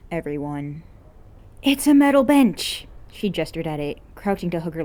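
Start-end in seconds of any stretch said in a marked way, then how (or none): 1.6-2.8 loud high complaint; shrieking at the cold bench; indignant shout. Acted. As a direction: protest like she's freezing. An complains loudly about cold.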